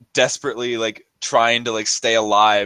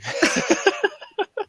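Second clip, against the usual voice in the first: high laugh. Real, not acted. Laugh after hungry remark.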